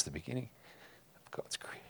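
fighting back tears while reading scripture; choked up. Real, not acted. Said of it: "I'm crying reading this stuff".

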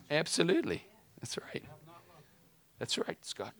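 whispered affirmation; soft whispered yes; whispered agreement. Real, not acted. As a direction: breathe it as a whisper.

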